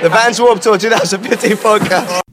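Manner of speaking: breathless, laughing loud sign-off. Real, not acted. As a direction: shout it out laughing with relief. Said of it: "You can hear me screaming for my life".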